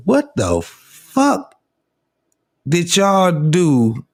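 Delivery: high, drawn-out exasperation; exasperated. Real, not acted. Host ranting at Baltimore people over their accent, exasperated. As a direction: stretch it out in baffled disbelief, pausing midway.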